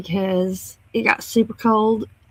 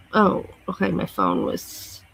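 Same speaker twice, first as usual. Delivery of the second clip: normal talking voice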